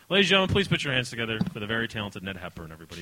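fast, booming host intro; grand entrance announcement. Real, not acted. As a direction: announce the guest like a showman. Host introducing guest on stage.

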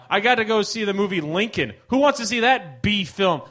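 ordinary delivery

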